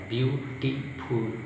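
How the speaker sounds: ordinary talk, nothing marked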